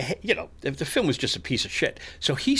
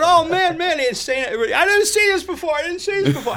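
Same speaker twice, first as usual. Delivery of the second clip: much higher, loud astonished cries; amazed exclaiming; 5 dB louder than usual. Retold or imitated. Heckler amazed at his own prediction, loud high cries. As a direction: marvel out loud in disbelief. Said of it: "he's going".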